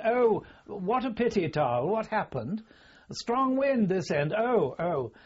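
high, loud mock sympathy; imitated commiserating officer. Generals to a junior who missed the bird, mock commiseration. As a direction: pitch it up like a senior officer consoling a poor shot.

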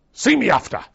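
loud, high barked order; sergeant's order. Retold or imitated. Veteran imitating flight sergeant ordering a recruit, loud. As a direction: bark it like a drill sergeant.

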